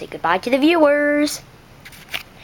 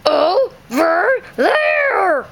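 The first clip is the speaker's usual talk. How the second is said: exasperated yell; 4 semitones higher, much slower than usual. Acted. Stranger fed up, yelling the direction slowly. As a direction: lose patience shouting it. Frustrated stretched answer.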